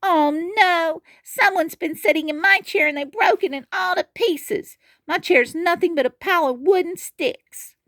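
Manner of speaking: high squeaky little cub; tiny bear upset at broken chair; squealing Baby Bear. Acted. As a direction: pitch way up and squeal it. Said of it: "the very small Baby Bear squealed".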